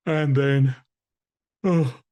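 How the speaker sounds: slow, yawny delivery; yawning. Shown as a demonstration. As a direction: talk through a big bored yawn. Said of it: "people yawning away".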